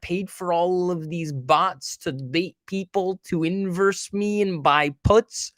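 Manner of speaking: high, slow, put-on voice of an old central banker; Janet Yellen impression. Streamer mocking the Fed chair, high slow parody voice. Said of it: "my Janet Yellen impression is spot on".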